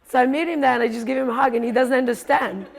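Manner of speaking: normal talking voice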